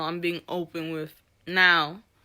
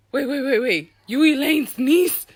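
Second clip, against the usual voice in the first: higher, louder mock double take; imitated surprised recognition; 5 semitones higher, 5 dB louder than usual. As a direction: act out his sudden recognition. Homeboy's surprised recognition, acted out.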